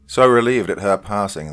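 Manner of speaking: plain reading voice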